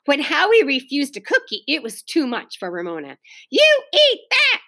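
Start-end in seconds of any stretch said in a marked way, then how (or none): 3.5-4.6 put-on high, slow, loud child's order; shouting. A narrator's reading. Angry hostess orders a guest to eat, shouting. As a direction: shout it high, leaning on each word. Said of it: "she shouted".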